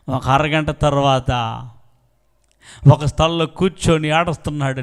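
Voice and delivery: usual speaking voice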